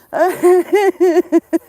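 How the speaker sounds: very loud, high howl of laughter. Real, not acted. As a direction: throw your head back and howl. Laughing fit.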